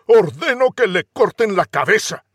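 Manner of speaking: hammed-up furious prince; commanding prince. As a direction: order the beheading imperiously. Prince orders execution.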